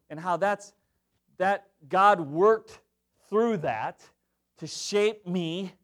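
ordinary delivery

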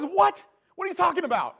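high, loud panicked outburst; freaking out. Retold or imitated. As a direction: yelp it in a frightened panic. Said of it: "this guy's freaking out".

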